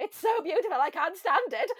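lightly German, high and loud, cat rapturing; a hint of a German accent, hammed up; mock German cat. Voicing the cat Tigger with a mild German accent. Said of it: "Tigger's German in my head".

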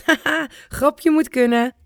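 put-on a laughing grandpa, teasing the kids. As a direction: grandpa still laughing at his own joke, chuckling. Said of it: "ligt dubbel van het lachen".

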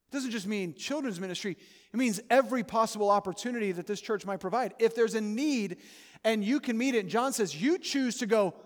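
loud, driving pulpit voice, pushing every phrase; a fired-up preacher's exhortation; preaching at full force. Acted. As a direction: preach it hard and call them to act. Pastor pressing the congregation hard.

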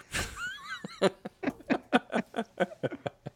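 high, soft trailing giggles; real laugh. Real, not acted. Host cracks up after his own car joke, giggling high and soft. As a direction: laugh quietly in a long, high run.

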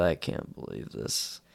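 low, soft, breathy mutter; muttered disbelief. Retold or imitated. Guy at the table in disbelief, low soft mutter. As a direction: groan it under your breath. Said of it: "puts his hand over his eyebrows".

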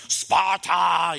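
loud, triumphant war shout; victorious battle cry. Acted. Movie king after killing the enemy, screaming victory. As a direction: bellow it like a conquering king. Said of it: "they start screaming a loud victory".

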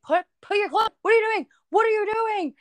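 put-on a mom scolding her drunk husband; lightly voiced frantic mom; mom alarmed. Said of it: "She's like".

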